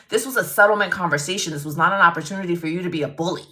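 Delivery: fast, heated tirade with forceful hits; angry dressing-down. Retold or imitated. Lawyer threatening opposing counsel with the ethics committee, retold fast and angry. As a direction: tear into him without pausing for breath.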